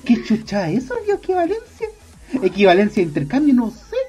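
high, rising mock bewilderment; clueless protest. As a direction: act out baffled exasperation. Acting his clueless reply, repeated louder.

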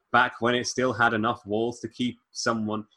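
normal talking voice

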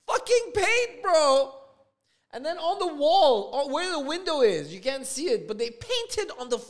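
loud, high-pitched exasperation; outraged rant. Real, not acted. Homeowner showing paint on socket and wall, outraged. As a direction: rant in rising, loud disbelief.

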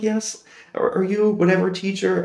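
lightly voiced nervous child; a hint of a shy student; timid kid reply. His younger self answering.

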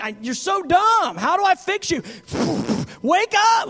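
hammed-up snap at dumb students; nasty exasperated yell. Preacher voicing a frustrated God yelling at us. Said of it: "negative, nasty attitude".

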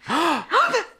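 high, theatrical gasp; mock gasp. Host reacts to the hospital reveal with a mock gasp. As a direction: gasp in pretend shock.

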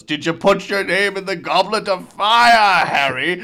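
loud shouting demand; yelling. Retold or imitated. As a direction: shake him and shout it. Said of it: "shakes him and yells".

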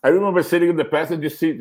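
ordinary talk, nothing marked

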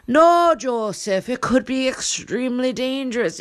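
high, protesting wife in hammy brogue; Charlotte Loughlin. Acted. Wife warning husband of danger, alarmed high voice. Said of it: "then Charlotte Loughlin said".

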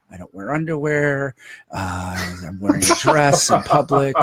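put-on warming up a Scottish accent; lightly put-on Scottish voice; mock Scottish accent. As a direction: try out a hint of Scottish. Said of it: "refined Scottish accent".

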